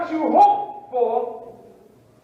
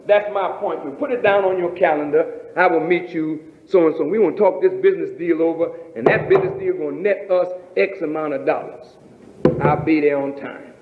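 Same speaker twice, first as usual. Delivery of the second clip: lower, fast, eager businessman voice; preacher voicing a man eager for his business deal; voicing a keen businessman; 8 semitones lower, faster than usual. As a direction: play the confident guy booking the meeting. Voices a person making appointment.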